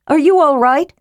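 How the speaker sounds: high, worried question; anxious. A narrator's reading. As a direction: check urgently on a sick brother. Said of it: "Caramon cried anxiously".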